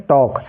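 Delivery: "टॉक" with no l; corrected "टॉक", vowel then k; silent L. Shown as a demonstration. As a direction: drop the L in "टॉक". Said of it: "एल का कोई उच्चारण नहीं".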